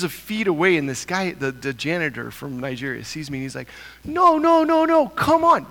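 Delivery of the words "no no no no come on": much higher, louder no no come on; urgent calling out. Retold or imitated. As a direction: call him back in a rush. Janitor urgently calling him back.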